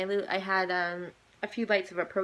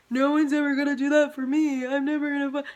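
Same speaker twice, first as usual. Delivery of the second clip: mock sobbing wail; 6 semitones higher, 5 dB louder than usual. Recalling sobbing at sad movie.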